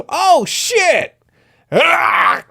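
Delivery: high, loud startled yelp; real burst of shock at a picture; shocked outburst. Real, not acted. Reader reacts to an image.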